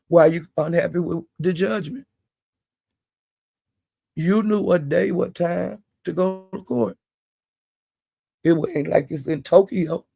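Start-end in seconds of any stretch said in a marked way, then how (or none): none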